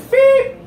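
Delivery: high, loud electronic beep; short piercing mock alarm; imitated scanner beep. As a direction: beep like a metal detector. Imitates airport security scanner.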